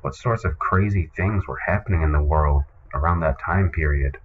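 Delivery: normal talking voice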